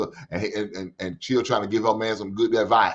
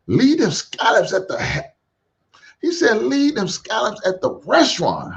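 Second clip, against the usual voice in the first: mock high voice; 11 semitones higher than usual. Host reading a viewer's super chat in a high mock voice.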